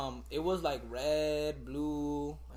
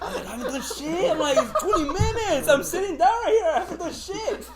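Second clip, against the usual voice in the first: laughing protest; 12 semitones higher, 5 dB louder than usual. Retold or imitated. Protesting innocence in class.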